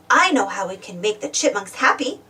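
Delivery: plain reading voice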